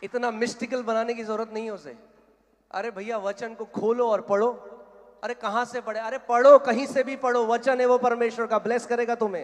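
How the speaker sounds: loud, high-pitched urging from the pulpit; forceful preaching exhortation. Real, not acted. Exhorts congregation at full force. (ज़ोर से ऊँची आवाज़ में उपदेश)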